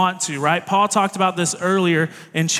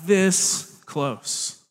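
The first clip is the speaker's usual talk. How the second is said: slow emphasis. Acted. Stretched for emphasis.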